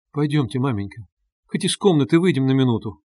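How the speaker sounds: ordinary delivery, nothing marked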